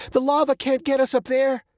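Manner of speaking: high, urgent character voice; urgent plea. A narrator's reading. Girl urges dad up the ramp, high and urgent.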